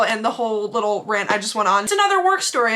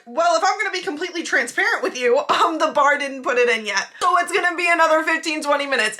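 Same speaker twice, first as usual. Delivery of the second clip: higher-pitched, pointed mock politeness; with an attitude; 5 semitones higher than usual. Hostess explaining the delay to customer, with attitude. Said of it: "Except I didn't say it with an attitude".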